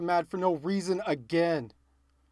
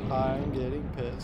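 lower, softer, slow simmering I'm getting pissed; quiet seething; 6 semitones lower, 4 dB quieter than usual. Real, not acted. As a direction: let the anger sink in quietly.

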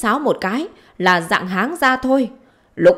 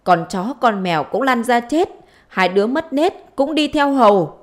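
wailing curse; 4 semitones higher, 3 dB louder than usual. Acted. Wife cursing her husband and his household, wailing curse. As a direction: chant the curses out, pitch swinging high.

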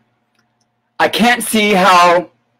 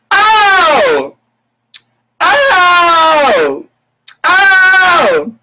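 mock shocked shrieks, much higher and louder; shrieked oh oh oh; 13 semitones higher, 4 dB louder, much slower than usual. Real, not acted. Vlogger shrieking in mock shock at a TV quote. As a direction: squeal each "Oh!" in fake outrage.

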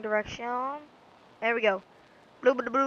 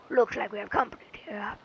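whispering. Acted. Reading villain dialogue.

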